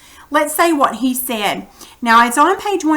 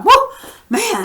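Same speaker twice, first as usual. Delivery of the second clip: amazed; 10 semitones higher than usual. Retold or imitated. Voicing an amazed onlooker.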